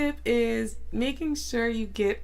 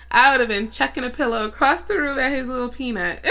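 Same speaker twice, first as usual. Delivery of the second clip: laughing; 6 semitones higher, 5 dB louder, faster than usual. Real, not acted. Laughing while telling.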